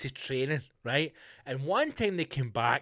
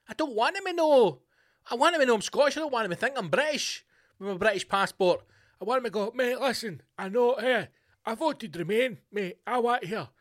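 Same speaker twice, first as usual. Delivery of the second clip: higher-pitched, insistent protest; mock pleading; 7 semitones higher than usual. Imagining pleading with the Dutch as a Scot who voted Remain, mock pleading. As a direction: play at earnestly pleading your case.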